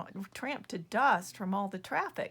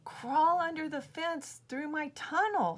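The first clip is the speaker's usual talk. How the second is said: higher, brisk little critter voice; perky prairie dog; 8 semitones higher, 3 dB louder than usual. Acted. Prairie dog suggesting tunnel to buffalo, perky higher voice. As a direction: pipe up eagerly as a small rodent.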